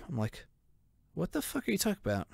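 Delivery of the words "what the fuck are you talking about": higher, fast, disbelieving; incredulous. Retold or imitated. Answering security's accusation, fast incredulous question. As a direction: fire it back in disbelief.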